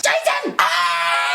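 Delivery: high, loud screech for help; panicking. Retold or imitated. Kid tugging at stuck shirt, panicked scream. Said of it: "I'm still panicking with my shirt like this".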